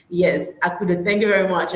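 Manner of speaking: ordinary delivery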